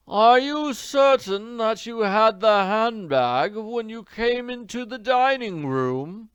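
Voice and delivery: slow, lazy, doubting drawl. A narrator's reading. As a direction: stretch the words out lazily. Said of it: "This came in a drawling voice".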